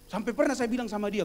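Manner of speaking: normal talking voice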